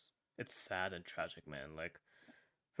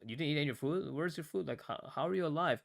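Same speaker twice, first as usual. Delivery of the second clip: higher-pitched retold bewilderment; confused; 6 semitones higher, 3 dB louder, faster than usual. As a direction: ask it like you can't make sense of it. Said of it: "so confused".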